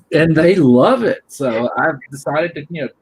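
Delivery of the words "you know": ordinary delivery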